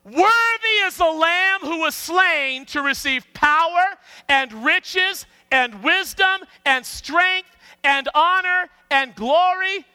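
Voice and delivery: high, slow congregational shout; loud voice. Shown as a demonstration. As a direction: proclaim it at full volume, word by word. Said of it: "we just need to crank the volume up".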